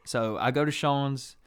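ordinary delivery

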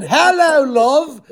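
high, loud cheeky British greeting; screamed British accent. Retold or imitated. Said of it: "I'm screaming".

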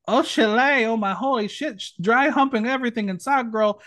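a light imitation of a zany comic voice, thrown out fast; wild wacky Robin Williams voice. Recapping a contestant's Robin Williams bit, in a lightly hammed-up wacky voice. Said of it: "I don't know what the voice was, but it was wild and wacky".

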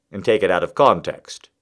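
ordinary talk

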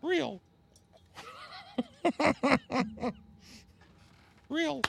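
high falsetto pretend voice; squeaky fake Paige voice. Answering as Paige.

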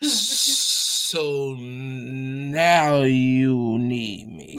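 drawn-out sassy put-down; slow, sarcastic diva tone. Retold or imitated. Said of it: "It is sitting there like".

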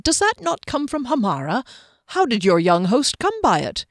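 somewhat overdone curious questioning; exaggerated curiosity. A narrator's reading. Giskellen probing where the kawa came from, exaggeratedly curious. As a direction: lay the curiosity on a little thick. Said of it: "somewhat exaggerated curiosity".

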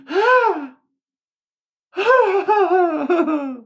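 very high imitated kid wailing, laughing through it; toddler wail. Mom imitating her daughter's floor tantrum, high wailing.

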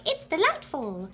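put-on high, slow, charmed child voice; delighted little girl. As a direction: savor it like a charmed young girl. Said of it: "who was like".

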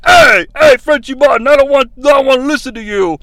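high, loud, fast angry heckle; mock screaming fan. Angry fan screaming at Frenchie Martin, high mock yell. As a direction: yell it like a furious denim-clad fan. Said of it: "screaming like".

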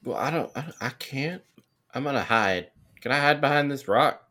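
usual speaking voice

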